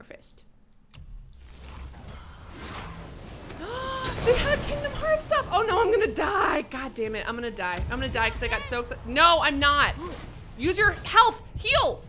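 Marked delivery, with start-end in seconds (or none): 0.9-4.2 long high breathless gasp; excited gasp. Real, not acted. Spotting Kingdom Hearts items in the game: long excited gasp. 4.2-6.6 much higher, louder thrilled cry into panic; excited squeal. Real, not acted. Finding Kingdom Hearts items mid-fight: high, loud excited cry. 9.1-12.0 higher and louder urgent commands; frantic yelling. Real, not acted. Urging her character to heal.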